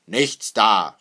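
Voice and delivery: forceful, very loud veto; loud refusal. A narrator's reading. Father refuses his daughter's excuse, calling out loudly. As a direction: stamp out the excuse in two words. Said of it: "rief Hackendahl".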